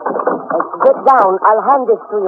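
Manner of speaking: calling over engine. Acted. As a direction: shout the handoff over the plane. Shouting over plane engine.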